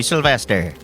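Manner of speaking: ordinary delivery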